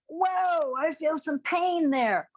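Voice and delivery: high, slow pained whoa; voicing a student feeling pain. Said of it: "Some of you are gonna go".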